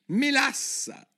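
slow, very high drowsy voice; sleepy voice. A narrator's reading. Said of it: "disse una voce sonnacchiosa". (voce sonnacchiosa, lenta e acuta)

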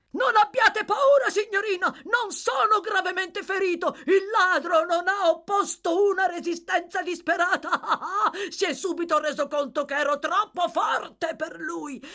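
high, loud boastful shouting with a laugh; excited boasting. A narrator's reading. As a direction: brag loudly up the stairs. Giles boasts loudly to the lady upstairs.

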